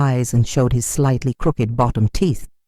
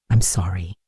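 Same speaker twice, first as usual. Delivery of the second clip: tearful whisper; 8 semitones lower than usual. Acted. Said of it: "he whispered against my shoulder, his voice breaking".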